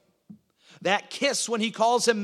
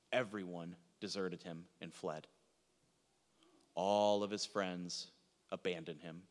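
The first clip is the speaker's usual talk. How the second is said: near-hushed, low and solemn; grave preaching; 11 semitones lower, 11 dB quieter than usual. Real, not acted. Preacher tells of Jesus accepting arrest, hushed and solemn. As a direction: let it fall soft and sombre.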